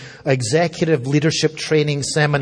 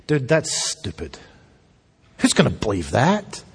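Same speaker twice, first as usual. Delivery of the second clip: lower, dismissive dude voice; mock scoffing; 5 semitones lower than usual. Preacher voicing mocking Gentiles, lazy scoff. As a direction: scoff like a bored skeptic.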